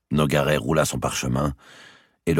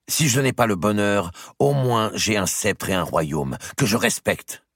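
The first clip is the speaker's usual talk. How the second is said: raised, measured queenly reply; proud retort; 8 semitones higher than usual. A narrator's reading. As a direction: answer your rival with dignity, slowing at the end. Cold queen answers her rival.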